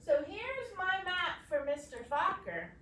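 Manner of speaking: normal talking voice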